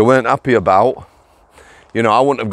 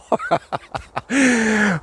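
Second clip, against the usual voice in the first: squealing laughter; high giggling fit; 9 semitones higher, 4 dB quieter than usual. Real, not acted. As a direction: crack up helplessly. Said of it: "Oh dear, I'm sorry, I'm so sorry".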